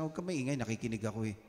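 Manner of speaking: soft hush to a talkative seatmate in church; hushed rebuke. Pretend. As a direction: quietly tell the chatty neighbour to hush. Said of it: "Sabi mo sa".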